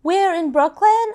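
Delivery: high, loud imitation of a repeated question; lightly voiced insistent caller; retold woman caller. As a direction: mimic the caller pressing for the place. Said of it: "she's like".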